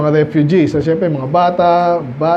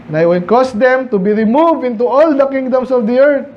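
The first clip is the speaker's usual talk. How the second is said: measured, raised Bible reading; scripture reading; 6 semitones higher than usual. Acted. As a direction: read the verse out as scripture. Reads scripture aloud. (pagbasa ng Bibliya)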